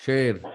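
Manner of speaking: "शेर" with a clear sh; tongue back to open "शेर"; SH demo. Shown as a demonstration. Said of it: "correct is the placement of tongue". ("शेर" में साफ़ श)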